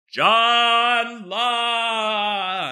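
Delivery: high, booming cartoon announcer; Super Friends announcer. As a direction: announce it like a Saturday cartoon narrator. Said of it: "like the Super Friends announcer".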